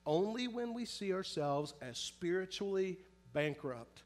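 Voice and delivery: ordinary talk, nothing marked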